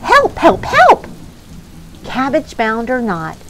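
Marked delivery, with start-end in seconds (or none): 0.0-1.1 panicked cry. Acted. Ants panicking in rain.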